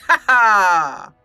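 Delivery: usual speaking voice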